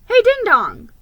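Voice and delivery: high, loud call across the snow; calling to a lone elf. A narrator's reading. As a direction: shout to get his attention. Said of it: "I called".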